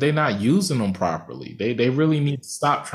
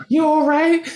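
much higher voice for her question; imitated concerned woman; 4 dB louder than usual. Moviegoer mimicking his date checking on him, high voice. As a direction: pitch it way up, mock concern.

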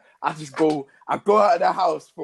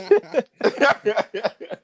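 burst of laughter; 6 semitones higher, 3 dB louder than usual. Real, not acted.

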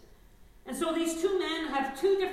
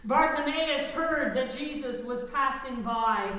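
ordinary talk, nothing marked

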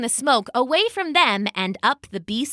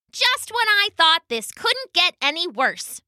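high, complaining cheetah voice; high-pitched sulky cheetah; whiny cheetah; 9 semitones higher than usual. Acted. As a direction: moan it like a sulky big cat.

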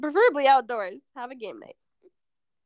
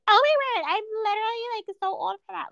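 much higher mocking voice; mock disdain; 10 semitones higher than usual. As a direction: put on a high voice to mock someone too cool. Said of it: "don't be like".

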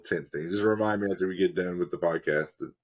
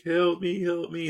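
high, pleading cry for help; 11 semitones higher, slower than usual. Retold or imitated. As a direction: call out like a lost person. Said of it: "they'll be like".

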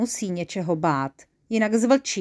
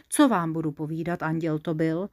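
ordinary delivery, nothing marked

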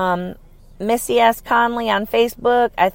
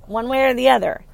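usual speaking voice

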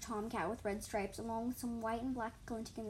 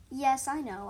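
proud reply; 3 semitones higher, 9 dB louder, slower than usual. A narrator's reading. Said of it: "I responded proudly".

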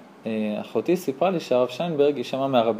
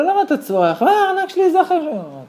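mocking wife; 4 dB louder than usual. Rebbetzin mocks husband's shouting.

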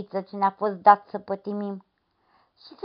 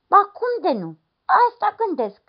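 eager lad; 14 semitones higher, 5 dB louder than usual. Acted. Lad insists to the woman.

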